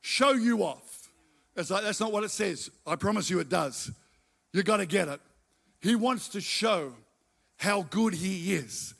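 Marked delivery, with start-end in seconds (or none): none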